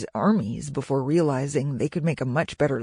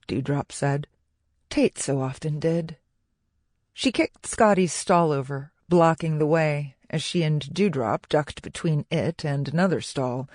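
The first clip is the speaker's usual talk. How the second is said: plain reading voice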